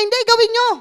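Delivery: high, loud pushy mom voice; mother insisting her son do it now; insistent mother. Retold or imitated. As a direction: mimic a mother who won't take no. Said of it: "nanay talaga eh".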